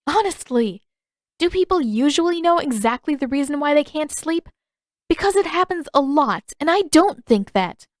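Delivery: high, loud incredulous exasperation; indignant, you can't believe he asked; exasperated. Acted. Katara exasperated by Pakku's question.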